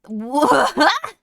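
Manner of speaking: incredulous laughing shout; laughing disbelief. Real, not acted. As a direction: blurt it out in shock. Shocked at chat message.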